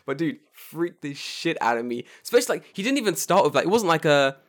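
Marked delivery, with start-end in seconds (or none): none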